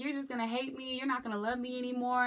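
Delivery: retold crying plea. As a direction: plead through tears. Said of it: "I'm crying".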